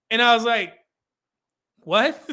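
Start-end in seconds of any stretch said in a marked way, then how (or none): 1.8-2.3 laughing disbelief. Real, not acted. Laughing at her reply.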